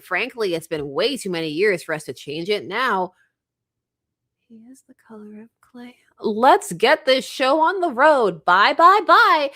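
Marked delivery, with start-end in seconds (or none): 4.5-6.0 whispered aside; hushed whispered correction. Real, not acted. 6.2-9.5 louder playful announcer read; loud hammed-up. Reads comic's loud exclamations.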